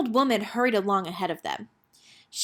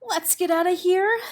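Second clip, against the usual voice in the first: ordinary delivery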